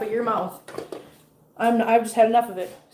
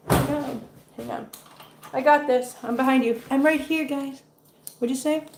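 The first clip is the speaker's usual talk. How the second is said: usual speaking voice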